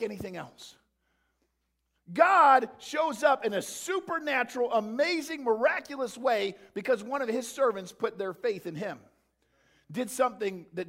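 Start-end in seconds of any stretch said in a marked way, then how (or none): 2.1-9.0 preaching climax. Real, not acted. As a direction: proclaim the miracle to the congregation.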